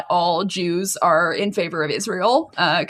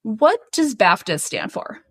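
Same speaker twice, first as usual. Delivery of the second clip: ordinary delivery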